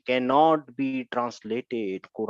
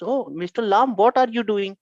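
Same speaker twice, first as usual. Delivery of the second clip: higher, louder questioning voice; questioning Inna; 6 semitones higher, 4 dB louder than usual. Acted. Inna asks Mister Lam what he is doing, questioning in a raised voice. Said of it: "He asked".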